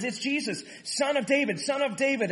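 high-pitched, urgent repeated call; imitated calling out. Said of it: "He calls out and says".

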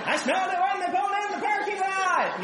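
high, loud excited yell, laughing; mock shout. Pastor shouting silently at his office wall. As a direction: yell it like you can't hold it in. Pastor yelling inwardly at overheard talk.

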